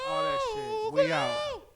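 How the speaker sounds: squeaky sign-off. Acted. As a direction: sign off in a silly high voice. Signing off in a funny voice.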